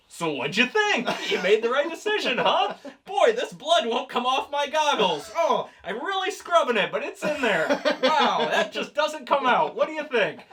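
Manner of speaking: oblivious high-pitched chatter, quick to gush; high, chirpy and blithely unaware; Booster. Acted. Booster chatting to Bruce and Selina about his bloody goggles, oblivious.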